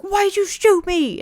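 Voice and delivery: high, loud indignant yelp; outraged opponent. Retold or imitated. Shot kid yelling at the shooter, high loud outrage.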